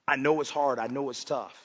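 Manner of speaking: tender, hushed voice of comfort; tender reassurance. Acted. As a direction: reassure a hurting son gently. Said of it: "he says".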